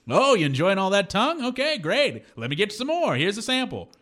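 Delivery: high, gushing host working the tables; glad-handing owner. Retold or imitated. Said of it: "he is glad-handing everybody".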